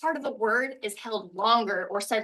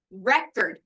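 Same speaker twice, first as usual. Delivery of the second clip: "record" as REK-erd, front punched, end falling; stress on first syllable. Shown as a demonstration. Teacher shows the disc that plays music, stress on first syllable of "record". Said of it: "but stress is different".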